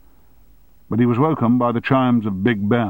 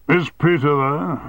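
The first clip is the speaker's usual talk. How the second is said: slow, higher, louder demand; booming; 7 semitones higher, 3 dB louder, slower than usual. Acted. Chairman phoning his deputy's home, booming. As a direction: boom down the phone. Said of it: "he boomed".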